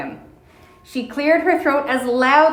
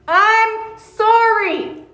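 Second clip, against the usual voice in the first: slow, higher and louder apology; repeated loudly; 10 semitones higher, 4 dB louder, much slower than usual. Acted. Mona repeating her apology to the deaf old bear, slow and loud. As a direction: say it slowly and loudly so he hears. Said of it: "she repeated loudly".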